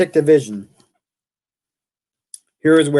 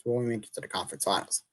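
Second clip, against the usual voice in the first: much softer, fast, words swallowed by a yawn; real yawn; 3 semitones lower, 14 dB quieter, faster than usual. Real, not acted. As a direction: mumble it through a real yawn, tired. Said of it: "I'm yawning".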